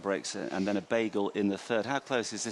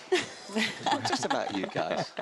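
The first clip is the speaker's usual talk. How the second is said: slow teasing line through a laugh; 8 semitones higher, slower than usual. Real, not acted. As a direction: tease while laughing.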